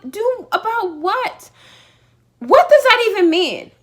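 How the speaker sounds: loud, high-pitched exasperated comeback; acted-out outburst, voice jumping up; indignant retort. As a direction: retell it as an incredulous, heated demand. Retold quarrel, indignant demand.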